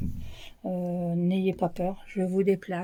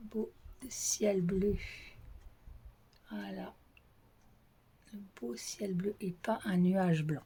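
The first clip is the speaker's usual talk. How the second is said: whispered; 10 dB quieter than usual. Real, not acted. Showing viewers the clear blue sky in a whisper. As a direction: hush it as if not to break the calm. Shows the sky while filming outdoors.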